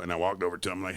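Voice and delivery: ordinary delivery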